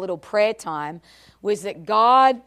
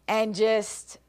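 usual speaking voice

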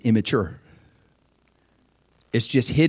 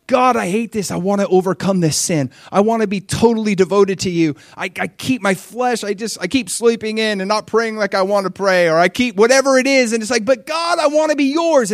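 a touch of pleading prayer; 8 semitones higher, 4 dB louder than usual. Retold or imitated. Voiced earnest prayer.

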